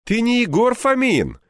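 mock serious. A narrator's reading. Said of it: "с серьёзным видом проговорил".

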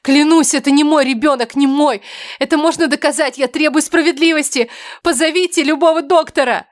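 high, loud, urgent protest; desperate shouting. A narrator's reading. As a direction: cry out for justice, pitched high. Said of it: "Я крикнула не ради милосердия".

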